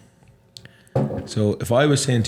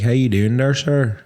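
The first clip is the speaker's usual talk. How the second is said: lilting Northern Irish greeting; Northern Irish hello, pitched a touch up; Northern Irish accent; 2 semitones higher than usual. Shown as a demonstration. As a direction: put on a North of Ireland accent. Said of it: "from the north of Ireland, you sound like this".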